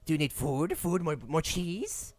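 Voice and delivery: a squeaky, eager rat lord; Rat King voice. Acted. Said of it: "the Rat King enters the room".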